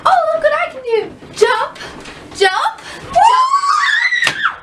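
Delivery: high, loud, giddy boasting; hammed-up excited jumper; show-off jumper. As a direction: build to a squealed last jump. Acts out trampoline show-off.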